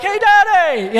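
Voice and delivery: high-pitched mock little-boy call; excited young son. Son calling to dad before leaping at him, excited. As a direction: call out like a boy about to leap. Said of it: "you're like".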